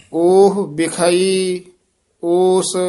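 slow, measured chant with held endings; teacher's deliberate Gurbani reading; scripture recitation. Acted. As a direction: intone sacred verse phrase by phrase.

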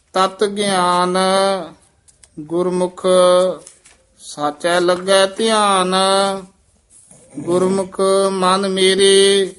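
measured, chanted scripture reading; scripture recitation with long pauses; slow Gurbani recitation. Shown as a demonstration. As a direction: recite each phrase slowly and devoutly. Teacher chants Gurbani path.

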